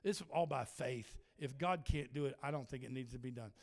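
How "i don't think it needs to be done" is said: ordinary delivery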